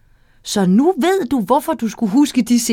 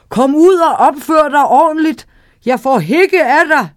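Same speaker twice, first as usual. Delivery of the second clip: louder, higher shout down its own throat; whale shouting down; 4 semitones higher, 4 dB louder than usual. Acted. Whale shouts down its throat to the sailor, loud and cross. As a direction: call down the throat, queasy and cross. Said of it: "hvalen råbte ned gennem sin egen hals".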